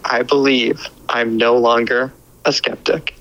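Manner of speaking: ordinary talk, nothing marked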